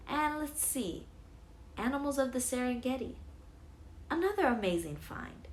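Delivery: lightly voiced cheerful librarian; friendly clerk voice.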